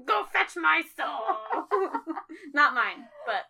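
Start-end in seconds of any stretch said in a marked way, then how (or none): 0.1-1.7 commanding master voice. Acted. Playing hellhound's master giving orders.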